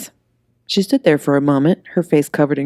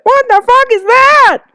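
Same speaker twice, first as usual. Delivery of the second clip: much higher and louder shriek, slow; screaming; 10 dB louder than usual. Acted. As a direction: shriek in horror at the monster. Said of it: "she screamed right through it".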